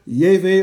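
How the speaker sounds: "Yahweh" said as YAY-vay; variant pronunciation. Shown as a demonstration. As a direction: say "Yahweh" with a v and matching eh vowels. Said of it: "however you want to pronounce it".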